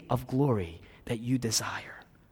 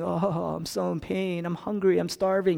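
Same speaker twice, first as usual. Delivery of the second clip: mock moaning; 6 semitones higher than usual. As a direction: imitate someone moaning in self-pity, lightly. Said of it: "moaning and saying".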